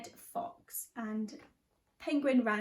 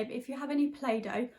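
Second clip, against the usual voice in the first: ordinary talk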